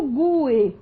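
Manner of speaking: gue split in two, GU-e; hiatus, stressed U. Shown as a demonstration. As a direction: stress the U in gue, then a separate vowel. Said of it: "A mesma situação".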